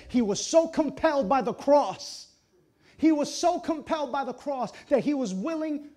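loud, high pulpit cry; impassioned, high and ringing preaching; preaching at full force. Real, not acted. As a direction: proclaim it to the whole congregation.

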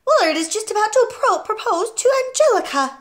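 high and loud, breathless urgency; frantic protest. A narrator's reading. Wife begging to hear the TV proposal, frantic. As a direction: wail about missing the big moment. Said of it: "Missus Wormwood cried out".

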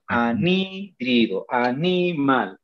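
slow "Anhídrido, animal" with a long NI; syllable by syllable. Shown as a demonstration. Teacher models for class, "Anhídrido, animal" with NI drawn out. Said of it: "tal como se pronuncia".

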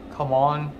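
ordinary talk, nothing marked

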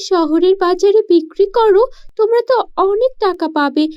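put-on a poor village girl puzzling aloud; young girl voice.

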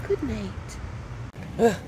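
normal talking voice

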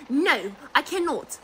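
ordinary talk

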